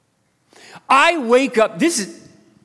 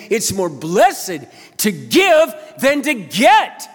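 much higher and louder, driving the point home; full-force preaching; 6 semitones higher, 4 dB louder than usual. Acted. Pastor rouses the church about serving his wife, booming. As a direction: preach it out with fire.

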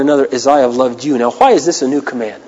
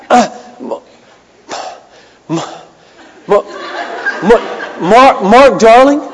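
nervous stammer; 7 semitones higher, slower than usual. Retold or imitated. Nervous young man on phone.

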